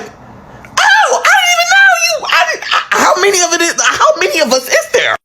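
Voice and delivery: re-enacted shock in a very high squeal; high-pitched, stammering disbelief. Telling how she met an unknown brother, shocked.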